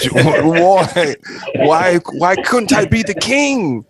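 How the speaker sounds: high, slow, whiny protest; mock indignant. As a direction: whine like you've been insulted. Mock protest at jester role.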